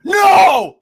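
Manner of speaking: sudden loud, high "No!"; shouted objection. Retold or imitated. Boss to colleague about deleting episodes, shouting in alarm. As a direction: explode in a full-volume shout of protest.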